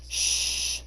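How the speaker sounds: a soft, breathy shush; hushing. Acted. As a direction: hush her gently. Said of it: "the Pegasus said".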